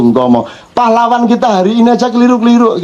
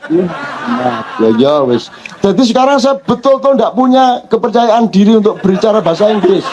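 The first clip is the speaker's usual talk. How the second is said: usual speaking voice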